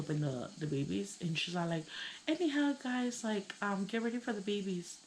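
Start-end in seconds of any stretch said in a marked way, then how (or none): 2.3-5.0 lightly imitated high-pitched teacher announcement; teacher addressing class.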